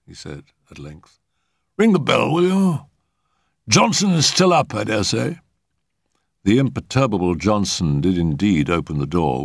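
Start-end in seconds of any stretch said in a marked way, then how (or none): 1.8-5.4 high-pitched gentleman's request; high, formal uncle character; directing uncle. A narrator's reading. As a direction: give a polite order in a high voice. Uncle directs his nephew.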